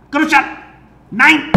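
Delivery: put-on barked, high, loud German accent; movie villain german accent. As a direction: snap it out like a movie Nazi officer. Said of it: "read this whole thing in a German accent".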